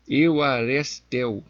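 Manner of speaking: Euarestel drawn out very slowly; unsure pronunciation. Real, not acted. Preacher attempting a Greek word for viewers, slow unsure pronunciation. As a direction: sound out Euarestel slowly, feeling through the syllables. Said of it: "Eu não sei como é que pronuncia".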